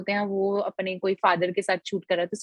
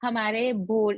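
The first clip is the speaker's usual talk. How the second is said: usual speaking voice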